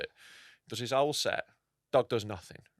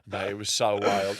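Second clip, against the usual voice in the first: usual speaking voice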